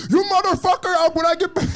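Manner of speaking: high, loud outraged threat; mock furious yelling. Prank victim threatening the prankster, mock furious yell. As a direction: rage at them in a high imitating voice. Imitates person raging in prank video.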